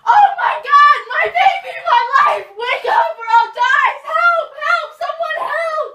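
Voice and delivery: very high, very loud shrieking; wailing scream. Acted. Mother over her dead child, shrieking. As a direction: shriek in grief for help.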